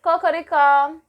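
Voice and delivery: loud, high cocoricó; hammed-up rooster call; mock rooster crow. Shown as a demonstration. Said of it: "som do galo cantando".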